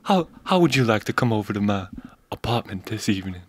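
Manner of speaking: lightly voiced flirt; sultry Pam Grier. Impersonating Pam Grier inviting someone over, sultry. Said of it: "That's Pam Grier".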